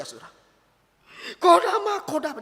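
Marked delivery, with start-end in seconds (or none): 1.4-2.4 high, mock-tearful wail; imitated crying. Young woman coming to her pastor in tears, imitated crying. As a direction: call the name as if weeping. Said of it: "Dia datang sambil nangis-nangis".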